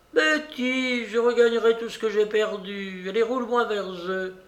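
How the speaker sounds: put-on high-pitched stubborn old lady; determined babouchka. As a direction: insist in an old lady's high, bossy voice. (vieille dame entêtée, voix aiguë)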